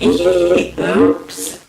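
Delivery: low, loud mock oops; drunken oops. Drunk daughter owning up to mom, low comic oops. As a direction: drop it deep, like a drunk. Retells her drunk reaction.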